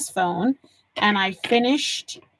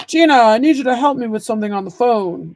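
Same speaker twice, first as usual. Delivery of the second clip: loud, quick impression of Dad; imitating her dad calling for help; 7 dB louder, faster than usual. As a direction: put on a loud older-father voice, hurried. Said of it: "That was my dad voice".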